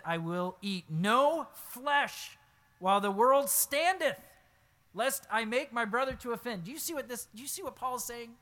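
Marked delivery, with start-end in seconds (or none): 0.0-6.6 loud, slow, fervent reading of the verse; impassioned scripture reading. Acted. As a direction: proclaim the vow slowly and with force. Preacher reads Paul's vow aloud.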